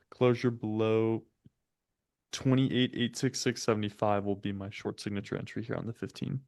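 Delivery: ordinary talk, nothing marked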